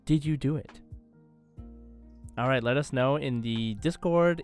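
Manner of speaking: usual speaking voice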